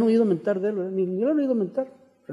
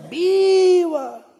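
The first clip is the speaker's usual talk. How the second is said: very high, long-held cheer; crowd's answering cry; 11 semitones higher than usual. Acted. All the townsfolk answering the last cry, drawn-out acted cheer. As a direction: echo it back as the whole town. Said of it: "Y todos".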